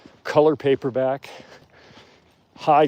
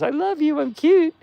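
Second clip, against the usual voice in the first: much higher, tiny sing-song cute voice; cutesy wolf voice; 4 dB louder than usual. Pretend. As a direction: squeak it like an adorable pet begging. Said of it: "she looks at me and she says".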